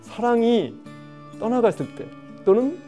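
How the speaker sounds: ordinary talk, nothing marked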